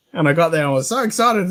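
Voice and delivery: ordinary talk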